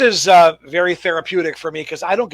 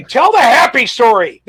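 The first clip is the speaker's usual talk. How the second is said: eager call; 6 semitones higher, 6 dB louder than usual. Real, not acted. Host urging guest eagerly.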